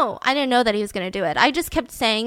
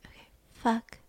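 whisper; 8 dB quieter than usual. Retold or imitated. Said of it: "So she said".

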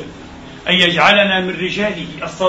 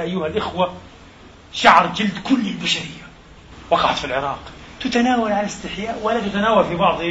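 usual speaking voice

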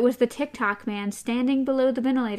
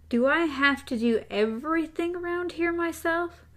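exasperated; 5 semitones higher than usual. A narrator's reading. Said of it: "more exasperated than angry".

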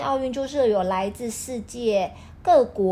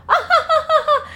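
high loud mocking laugh; gloating laugh; 7 dB louder than usual. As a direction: cackle like a smug winner. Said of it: "他会站在终点说". (高声得意大笑)

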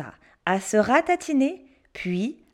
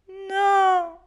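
high, slow whiny no; stubborn child refusing; 8 semitones higher, 5 dB louder, much slower than usual. Acted. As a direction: drag out a sulky refusal. Pierre refusing soup.

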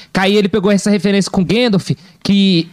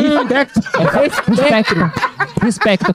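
laughing mispronunciation; 3 semitones higher, much slower than usual. Pretend. Joking mispronunciation of Lispector.

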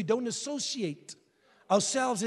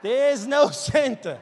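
put-on child insisting; 3 semitones higher, 7 dB louder, slower than usual. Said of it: "And he's like".

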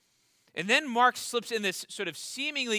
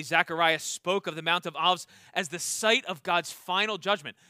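ordinary talk

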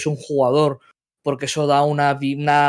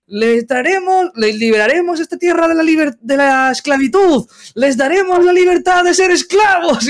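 Castor Peck voice; 12 semitones higher, 4 dB louder than usual. Acted. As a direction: proclaim it up high like a pompous huckster. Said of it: "creo que digo".

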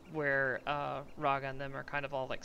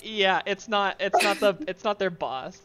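loud laughing talk; 6 semitones higher, 13 dB louder than usual. Real, not acted.

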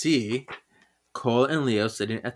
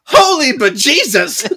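high, loud laughing shriek of surprise; startled exclamation; 12 semitones higher, 11 dB louder than usual. Retold or imitated. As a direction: yelp it like you've just been spooked. Paige startled by cat.

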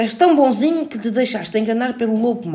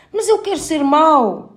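higher, slow pleading little wolf; 9 semitones higher, 5 dB louder, slower than usual. A narrator's reading. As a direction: beg to be bad as the small wolf.

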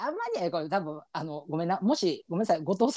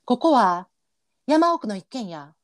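hammed-up Kansai narrator, pitched high; mock a cheesy comedy-show narrator; cheesy Shinkigeki opener narration, high and overdone; cheap narrator voice; 8 semitones higher than usual. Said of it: "みたいなやつやろ？".

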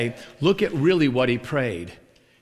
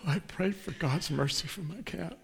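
emotional; 3 semitones higher, 11 dB quieter than usual. Retold or imitated. Cat owner tells of her prayer, mock emotional. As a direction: plead softly, imitating an upset woman. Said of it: "she got emotional".

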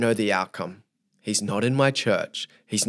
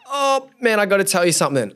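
reluctant confession; 9 semitones higher, 3 dB louder than usual. Retold or imitated. Acted reluctant confession to stranger.

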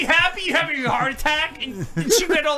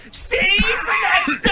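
imitating the psychic; 8 semitones higher, 3 dB louder, much slower than usual. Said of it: "look how she's like".

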